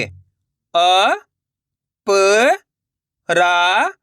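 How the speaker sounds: अ, प, रा each separate, long and rising; letter-by-letter demo. Shown as a demonstration. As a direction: sound out अ, प, रा with pauses. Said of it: "एक अक्षर का उच्चारण सही से". (अ, प, रा अलग-अलग खींचकर)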